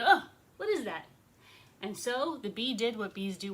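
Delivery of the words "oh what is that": higher, louder startled exclamation; startled bee. Acted. Bee frightened by the king's hand, startled. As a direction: jump in alarm as the bee. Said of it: "the bee went".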